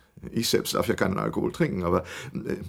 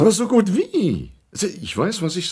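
higher, louder insistence; insistent retort; 9 semitones higher, 5 dB louder than usual. Acted. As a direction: snap back to insist. Old man insists against detective.

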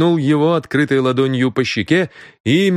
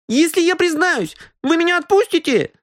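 put-on sly boy whimpering, very high. Caught boy bargains with the detective, whimpering. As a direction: snivel but bargain slyly. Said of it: "снова начиная хныкать".

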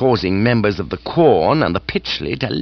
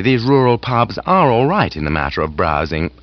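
usual speaking voice

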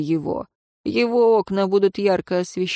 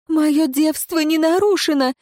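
put-on frightened young woman; 8 semitones higher than usual. A narrator's reading. Frightened girl denies, pleading.

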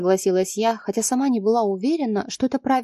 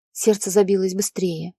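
ordinary delivery, nothing marked

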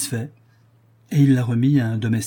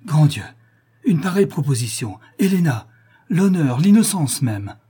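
higher, louder shocked exclamations; indignant outcry; 5 semitones higher, 5 dB louder than usual. A narrator's reading. Son cries out at the offer, indignant and loud. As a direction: cry out in outrage.

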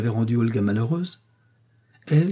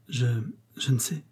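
a softer, faltering stammer; 5 dB quieter than usual. A narrator's reading. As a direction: stumble over the words in fright. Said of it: "balbutia-t-elle". (balbutiement apeuré, plus doux)

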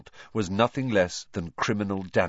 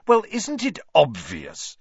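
slow, high and condescending; superior; 9 semitones higher, 6 dB louder, slower than usual. A narrator's reading. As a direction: talk down to him smugly. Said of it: "with a look of maddening superiority".

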